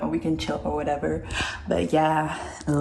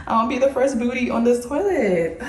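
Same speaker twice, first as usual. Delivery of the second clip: laughing; 7 semitones higher than usual. Real, not acted. Joking about the new toilet.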